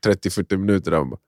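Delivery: ordinary delivery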